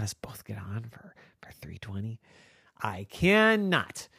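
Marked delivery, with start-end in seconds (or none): 0.0-2.2 whispered, pleading request; whispering. Acted. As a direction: beg softly in a whisper. Girl begs in a whisper.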